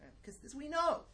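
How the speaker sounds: a mocking lift on this we know; mock certainty. Teacher mocking fashionable certainty, smug. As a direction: poke fun at the sure tone. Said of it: "whatever is thought at the moment".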